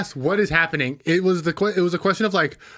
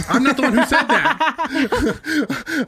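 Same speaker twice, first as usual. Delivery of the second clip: laughing; 8 semitones higher, much slower than usual. Real, not acted. Laughing defense among friends.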